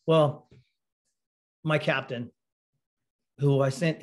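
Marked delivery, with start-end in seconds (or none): none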